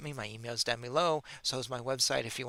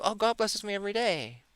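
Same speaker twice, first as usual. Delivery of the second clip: mock pious answer; 7 semitones higher than usual. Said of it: "That's probably what she would say".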